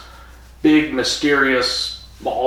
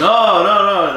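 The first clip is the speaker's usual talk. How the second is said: loud mock refusal; 8 semitones higher, 7 dB louder than usual. Time Lords reject Barusa.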